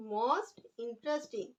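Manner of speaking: very slow, each word drawn out for writing; slow dictation. Shown as a demonstration. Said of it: "interesting की". (बहुत धीरे-धीरे लिखवाते हुए)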